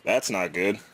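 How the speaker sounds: normal talking voice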